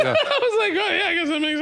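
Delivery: high-pitched talk through laughter; laughing. Real, not acted. Comic laughs at his own mishearing of the word, laughing through it. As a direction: crack up while getting the words out.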